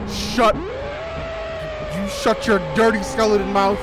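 high drawn-out yell; angry shout. Real, not acted. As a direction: shout down the skeleton. Yelling at screaming skeleton.

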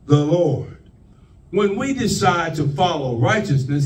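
ordinary talk

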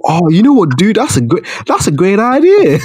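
high-pitched gullible dude; hammed-up excited agreement, cracking up at the end; mock enthusiasm. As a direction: play a protester thrilled by a daft plan. Mocks protesters agreeing to plan.

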